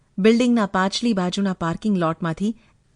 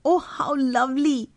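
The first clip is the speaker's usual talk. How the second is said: slow, higher, drawn-out delight; delighted exclamation; 4 semitones higher, much slower than usual. A narrator's reading. Nilima blurts out on seeing the necklace, drawn-out delighted exclamation. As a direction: exclaim it in long, admiring wonder. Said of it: "એકદમ બોલી ઉઠી હતી".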